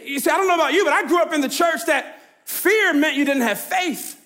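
ordinary talk

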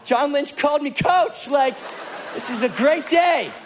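giddy fanboy rush; starstruck excitement. Retold or imitated. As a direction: gush like a kid meeting his hero. Starstruck by a famous player.

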